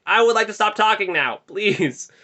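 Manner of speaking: high, loud mock request; hammed-up plea. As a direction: spell it out loudly to someone oblivious. Acting out his ignored hints.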